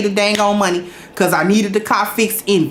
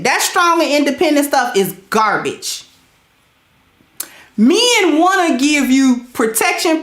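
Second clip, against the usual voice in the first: higher, slow, drawn-out sermon voice; preaching to the ladies; 5 semitones higher, slower than usual. Acted. Streamer lecturing the ladies in chat, slow higher preaching. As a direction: lay it down like a preacher, word by word.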